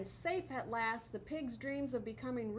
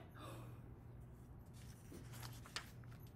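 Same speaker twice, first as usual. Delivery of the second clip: gasp; 15 dB quieter than usual. Acted. Pig startled by wolf.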